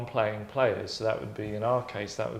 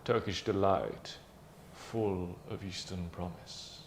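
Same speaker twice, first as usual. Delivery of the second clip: low, very soft, slow advert voice-over; imitating a hushed, mysterious pitchman; advert man's voice; 5 semitones lower, 10 dB quieter, slower than usual. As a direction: murmur it like a sultry confectionery advert. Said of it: "the guy would speak like this".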